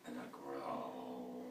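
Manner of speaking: deep, slow mock growl. Shown as a demonstration. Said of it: "What a growl is? That's a growl".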